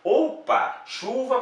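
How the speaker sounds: teacher feigns a surprised take; mock surprise. Shown as a demonstration. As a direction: play-act confusion at Chuva. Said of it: "parece que deu uma pequena confusão".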